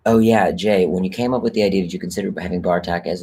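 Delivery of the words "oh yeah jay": normal talking voice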